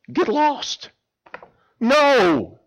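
high, sharp brush-off; curt refusal. Acted. Neighbor from the window refusing the man, high and curt. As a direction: shut him down flat.

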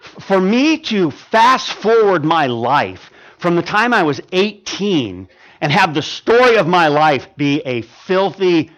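ordinary talk

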